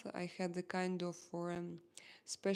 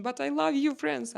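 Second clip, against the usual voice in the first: high, loud declaration of love; raised, emphatic outpouring; exclaimed affection; 7 semitones higher, 7 dB louder than usual. Real, not acted. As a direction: call it out with a rush of affection. Declaring love to France, calling out.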